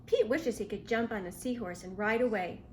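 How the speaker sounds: plain reading voice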